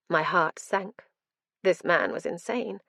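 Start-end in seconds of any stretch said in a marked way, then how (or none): none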